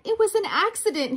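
high, quick, defensive protest; mock roar. A narrator's reading. Dragon answering the king's accusation, high hurried mock roar. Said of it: "he roared".

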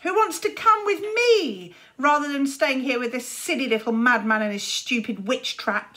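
put-on a boy shouting to win over followers; shouted appeal. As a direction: call out to the crowd. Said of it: "shouted Luther".

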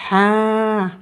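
"Ha." with the a held long; long vowel. Shown as a demonstration. Teacher shows the attached long vowel, "Ha." held long. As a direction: stretch the vowel of "Ha." steadily. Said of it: "Avec les voyelles longues".

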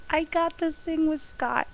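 high, giddy, thrilled exclamation; giddy excitement. Real, not acted. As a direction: gush it high, just after a laugh. Giddy recap of singing with idol.

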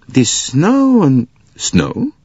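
a slow worried complaint; slow fretful voice. Acted. Character lamenting the snow to Poirot, slowly. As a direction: drag out the words, lightly fretful.

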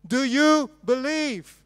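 slow, loud, high "Do you believe"; emphatic question. Shown as a demonstration. Preacher challenging the congregation, slow loud question. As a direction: press the congregation hard.

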